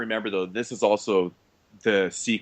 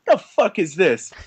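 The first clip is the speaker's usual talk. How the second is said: slow, high, louder annoyed growl; annoyed gangster; 7 semitones higher, 5 dB louder, slower than usual. Retold or imitated. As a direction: sneer it like a label boss. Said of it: "and he's like".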